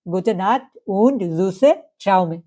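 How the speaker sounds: slow, careful model for students to repeat; drawn out teacher demo; slow German demo. Shown as a demonstration. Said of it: "কি বলবেন?".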